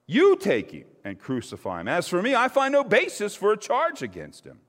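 rising into a high, loud refusal; exasperated Pilate. A narrator's reading. Pilate refuses the priests, high and loud. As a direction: throw it back at them, fed up.

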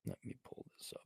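barely voiced whisper. Real, not acted. Searching his phone, whispering to himself. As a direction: breathe it out as a whisper. Repeats it breathlessly.

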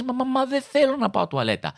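mock child whine, pitched high; whiny child. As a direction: whine like a kid who won't go. Child whining to mother.

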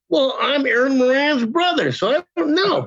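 high-pitched mock indignation; sarcastic brush-off. Retelling his sarcastic brush-off to agent.

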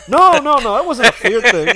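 loud, high, hurried denial; emphatic protest. Real, not acted. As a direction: jump in loudly to set the record straight. Protests a teasing accusation.

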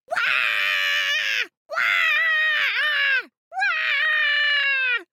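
put-on very high-pitched baby wailing; long piercing imitated infant cries; baby's loud wail. A narrator's reading. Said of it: "it yelled as loudly as it could".

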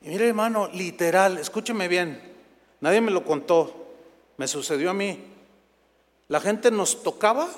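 ordinary talk, nothing marked

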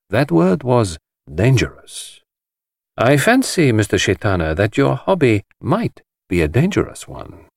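lightly voiced careful detective; a hint of a grave foreign detective warning. The detective warning the host, lightly voiced.

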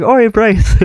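put-on rough Irish accent, hailing a mate; attempted Irish accent. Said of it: "can't really do ... an Irish accent".

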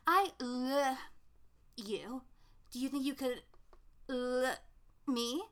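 halting, with pauses round LOVE; can't say love. Retold or imitated. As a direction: choke on the word "love", unable to get it out. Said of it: "uh, he can't say love".

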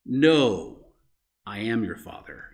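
low, sinking Vader voice, each word weighty; Darth Vader impression. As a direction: drop it low like the dark lord revealing the truth. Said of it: "And Darth Vader said".

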